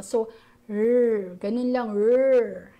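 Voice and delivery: "r" alone, long and loud, slow; bare r sound. Shown as a demonstration. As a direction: hold the "r" like a growl. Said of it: "Yan ang sound niyan". ("r" na walang patinig, mahaba)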